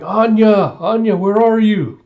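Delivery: loud, high acted search call; worried calling out. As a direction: call across a crowded hall for a lost child. Yor searching, calling for Anya.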